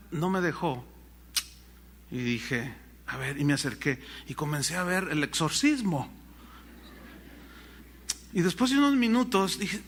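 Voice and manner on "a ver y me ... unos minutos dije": usual speaking voice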